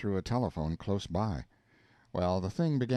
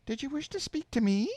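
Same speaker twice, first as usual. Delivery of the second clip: much higher, thin piping woman; piping female voice. Acted. The woman answers the caller in a piping higher voice. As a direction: pipe it up like a prim lady. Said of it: "a piping female voice".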